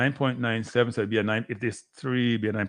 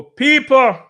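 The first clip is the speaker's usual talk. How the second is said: much higher, much louder shout of people; 12 semitones higher, 12 dB louder than usual. Real, not acted. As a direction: yell it out to the whole chat. Begging chat for likes.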